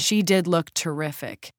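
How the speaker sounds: plain reading voice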